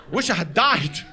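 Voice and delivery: ordinary talk, nothing marked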